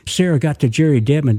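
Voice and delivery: ordinary delivery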